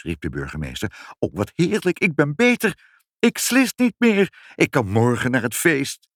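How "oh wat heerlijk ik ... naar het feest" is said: high delighted exclamations; rejoice, getting louder to the end; overjoyed. A narrator's reading. Mayor rejoices at being cured.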